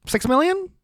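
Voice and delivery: high, rising incredulous question; mock disbelief. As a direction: act shocked by the number, voice jumping up.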